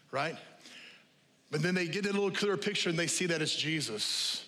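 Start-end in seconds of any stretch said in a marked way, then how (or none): none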